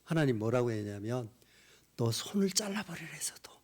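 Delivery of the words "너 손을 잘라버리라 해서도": plead, rising sharply on the last words; God crying out. Retold or imitated. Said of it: "이렇게 외치는 거예요". (점점 높아지는 하나님의 간절한 외침)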